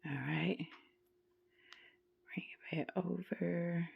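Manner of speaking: whispered under the breath while concentrating. Real, not acted. Crafter talking to herself over the knot, whispered. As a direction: murmur it to yourself as you work the knot.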